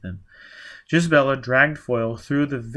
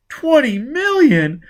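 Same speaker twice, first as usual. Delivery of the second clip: much higher, louder, slow stunned disbelief; astonished gasp; 10 dB louder, slower than usual. Acted. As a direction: let the sum hit you. Stunned at the fortune.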